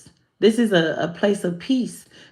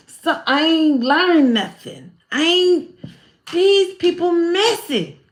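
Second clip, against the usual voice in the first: exasperated churchgoer; 7 semitones higher, slower than usual. Retold or imitated. Voicing own frustrated thoughts.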